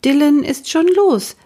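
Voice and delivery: apologetic mother speaking from the doorway; mother's voice. Acted. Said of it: "sagte seine Mutter im Bademantel".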